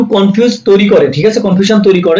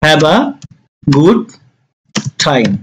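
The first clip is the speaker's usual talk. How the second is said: long pauses between words; slowly spaced teaching delivery; 3 semitones lower, much slower than usual. Shown as a demonstration. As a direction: break it up for learners. Teacher dictates phrase word by word.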